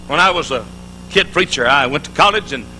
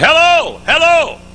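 high, loud hello shouted into the yard; loud, high-pitched calling out; 10 semitones higher, 4 dB louder, slower than usual. Retold or imitated. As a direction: call out to find anyone there. Calling out at empty church.

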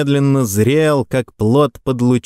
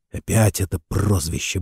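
plain reading voice

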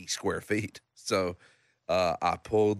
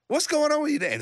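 much higher, prodding; imitating brother; 4 dB louder than usual. Brother prodding Dan about his mood, high mock voice. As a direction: poke at him like a pestering brother.

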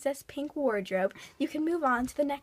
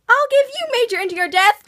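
angry shout; 9 semitones higher, 12 dB louder than usual. Acted. Threatening reply to PSA.

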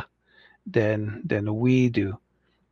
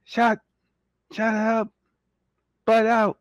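mock harsh; 9 semitones higher than usual. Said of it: "I hope I'm not being too harsh".

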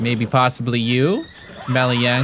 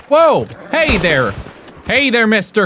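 sudden high yelp of surprise; startled whoa; 6 semitones higher than usual. Real, not acted. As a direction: jump at the shock.

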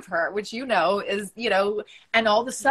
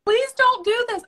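high, loud mock-desperate plea; mock pleading; 14 semitones higher, 7 dB louder than usual. Mocking how others beg a suicidal caller, high and loud. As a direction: parody the panicked "please don't" helper. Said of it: "I don't do the".

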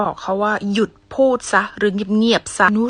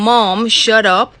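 mock rude; 6 dB louder, much slower than usual. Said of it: "สู้ลองจินตนาการภาพดู สิบอกแม่ว่า".

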